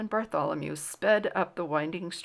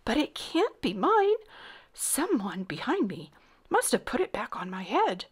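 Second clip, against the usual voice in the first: higher-pitched worried explanation; anxious, raised boyish pitch; flustered protest; 5 semitones higher than usual. A narrator's reading. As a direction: plead innocence to a king. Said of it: "he stammered".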